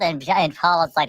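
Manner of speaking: usual speaking voice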